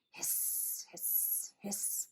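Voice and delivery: soft, voiceless hisses; snake hissing. A narrator's reading. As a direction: hiss like a storybook snake. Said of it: "went the snakes".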